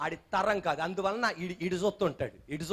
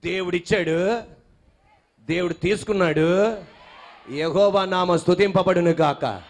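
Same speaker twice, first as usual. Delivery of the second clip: a lower, louder declaration; the congregation told to say it aloud, lower and loud; said loudly; 5 semitones lower, 5 dB louder, slower than usual. Shown as a demonstration. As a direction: call it out strongly. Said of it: "ఇప్పుడు గట్టిగా".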